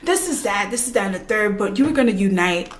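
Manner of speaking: lightly voiced smug lecturer, low and hushed; mock villain speech. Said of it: "He literally sat there and said".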